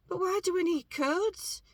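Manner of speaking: puzzled. Retold or imitated. Retold confused reaction.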